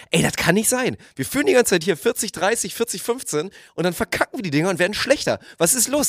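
high, worked-up questioning of a teammate; agitated teammate pep talk. Retold or imitated. Player to partner after blown leads, worked up. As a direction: rattle him awake, pitch up. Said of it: "und meinte so".

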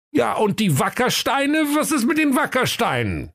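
high-pitched exasperated outburst; mock-furious inspector, pitch shooting up; raging. A narrator's reading. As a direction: demand it in a comic fit of temper. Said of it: "tobte Axel Milchbart".

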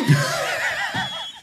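high squealing laughter; helpless fit of laughter; hysterical laugh. Real, not acted. Can't stop laughing at own joke.

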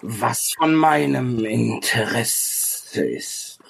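slow, stretched words with pauses; haughty ancient sage. Acted. Ancient sage rebuffs the travellers' offer, slow and haughty. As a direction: draw the words out with lofty disdain. Game master voices the sage.

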